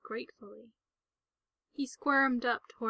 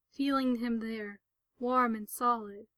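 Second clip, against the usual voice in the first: plain reading voice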